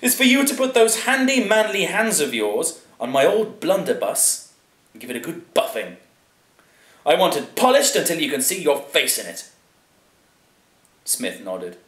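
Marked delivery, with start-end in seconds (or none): 0.0-9.5 high, loud lordly instructions; pompous aristocrat, pitched up and emphatic; imperious lord. A narrator's reading. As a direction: order the servant about with relish. Plays Lord Chatterley in a story.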